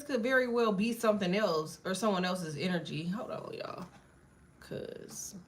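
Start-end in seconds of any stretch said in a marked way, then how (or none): 3.1-5.4 whispered aside. Real, not acted. Aside during reading.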